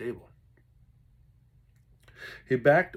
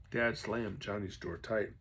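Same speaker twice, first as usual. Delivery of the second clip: ordinary delivery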